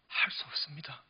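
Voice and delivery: hushed, unvoiced whisper of despair; a frightened whisper to God; whispered plea. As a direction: breathe it out as a helpless confession. Moses pleading with God.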